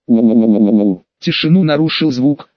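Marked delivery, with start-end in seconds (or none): 0.1-1.0 ghostly wail. A narrator's reading. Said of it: "похожий на крик призраков".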